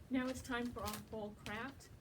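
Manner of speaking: plain reading voice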